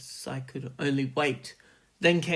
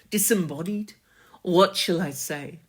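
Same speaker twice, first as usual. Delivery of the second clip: plain reading voice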